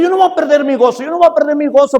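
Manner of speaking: loud, firm and fast on the first take; determined declaration. Retold or imitated. Preacher vowing to keep his joy, loud and firm. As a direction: stubbornly declare it, raising the voice. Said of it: "Yo decía".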